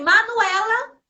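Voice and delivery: name called out high and loud; sharp call. Retold or imitated. Mother catches her daughter hitting the plant, calls her name loudly. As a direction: snap the child's name to stop her.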